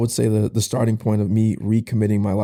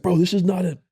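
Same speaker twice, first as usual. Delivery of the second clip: much higher, demanding coach who won't believe it; disbelieving coach; 9 semitones higher than usual. Retold or imitated. Head coach demanding the truth from player, agitated. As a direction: press hard for the truth.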